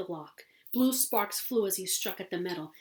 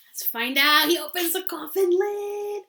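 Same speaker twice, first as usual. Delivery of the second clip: excited; 9 semitones higher, 8 dB louder than usual. Real, not acted. Reader excited at coffin reveal.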